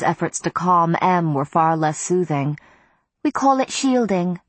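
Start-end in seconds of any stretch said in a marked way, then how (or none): 3.2-4.4 high, bright-ringing vampire lady; bell-like soprano. A narrator's reading. Miriam explaining to the witches, high soprano chime. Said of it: "her bell-like soprano chimed".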